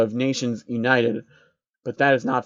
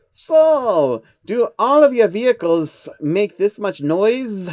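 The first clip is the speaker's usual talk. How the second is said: put-on high, slow childlike creature voice; wide-eyed little alien asking in wonder; childlike changeling; 8 semitones higher, 5 dB louder, slower than usual.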